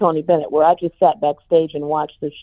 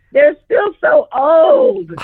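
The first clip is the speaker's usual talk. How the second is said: high, slow, cracking into laughter; laughing while complaining; 10 semitones higher, 5 dB louder, much slower than usual. Retold or imitated. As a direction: whine it through a laugh. Retelling her complaint, breaking up laughing.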